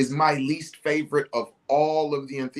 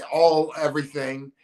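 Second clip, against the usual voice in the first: ordinary talk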